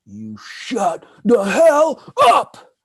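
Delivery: slow, loud barked order; mock angry shout. Neighbor shouting at his sister, slow, loud mock shout. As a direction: yell her down like a bully. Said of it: "he's like".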